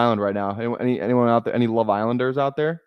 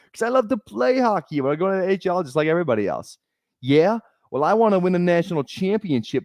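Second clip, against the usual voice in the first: higher-pitched Boston movie impression; Boston accent; 5 semitones higher than usual. Streamer acting Miracle bar argument between players, Boston accent. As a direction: play both sides of a quarrel in broad Boston. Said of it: "that's my Miracle Boston".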